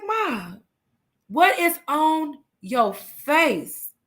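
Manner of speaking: put-on high, very slow kid's question; imitated insistent child. Kids all come to question mom, mimicked baffled insistence. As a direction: stretch each word out like a baffled child.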